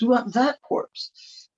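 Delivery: high, slow, odd little creature voice; insistent fly voice. Acted. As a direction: drag it out like a sly, tiny fly. Flies insisting on the corpse.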